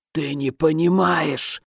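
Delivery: slow rasping dying voice; hoarse. A narrator's reading. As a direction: rasp it out slowly, dying. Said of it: "прохрипел инженер".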